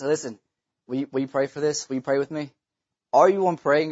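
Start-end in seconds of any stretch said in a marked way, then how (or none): 0.0-2.5 low, soft, confiding plea; quiet earnest request. Acted. Caller asking for prayer at night, low and soft. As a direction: drop the voice and ask gently.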